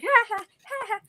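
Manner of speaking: high jeering mock laugh. Bullies' high mocking laughter at a mistake. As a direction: cackle at her like the bullies did. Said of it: "they would be like".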